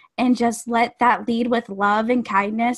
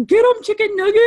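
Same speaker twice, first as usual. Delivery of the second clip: mock yell; 7 semitones higher, 4 dB louder, faster than usual. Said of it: "where it says".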